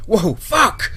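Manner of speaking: high, startled outcry; horrified shout. A narrator's reading. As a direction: shout out in shock. Tyler recoils from the dying Brady.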